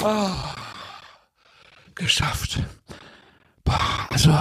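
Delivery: voiceless, sighing exhale on the words; airy, worn-out sigh; exhausted relief. Acted. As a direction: let it out as one spent breath. Clerk collapses after stressful shift.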